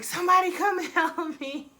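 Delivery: put-on a boyfriend calling out helplessly; lightly mocked panicked call; mock cry for help.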